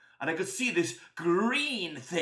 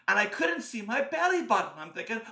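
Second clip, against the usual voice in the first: usual speaking voice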